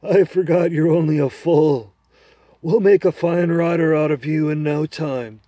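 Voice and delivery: put-on a confident horse making its case to the boy; talking horse. Horse plans escape.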